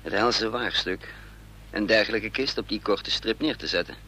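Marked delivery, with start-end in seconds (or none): none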